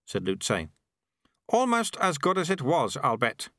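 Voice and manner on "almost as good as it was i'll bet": high-pitched, approving character voice; satisfied sweeper. Acted. Sweeper judging the restarted spinners, high and satisfied. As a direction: judge the work in a raised sweeper's voice.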